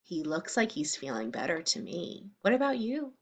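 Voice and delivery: ordinary delivery, nothing marked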